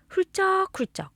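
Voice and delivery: high-pitched pretend sniffling; mock weeping. Narrator showing a child sad hearts, mock sniffling. Acting out sadness with sniffle word.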